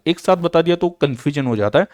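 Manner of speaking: ordinary delivery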